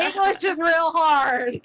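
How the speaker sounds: high-pitched, laughing self-mockery. Real, not acted. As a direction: laugh at your own tongue-tied slip. Laughing at own slip of tongue.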